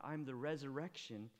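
usual speaking voice

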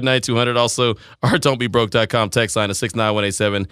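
usual speaking voice

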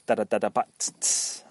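quick scat drum pattern; mouth drumming. Shown as a demonstration. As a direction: drum fill then whispered hi-hat hisses. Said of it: "speaking your drum lines".

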